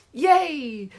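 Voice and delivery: high, loud cheer; shrieking. Acted. The class cheering about Ashton, high shriek. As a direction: shriek with delight. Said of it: "shrieked the class".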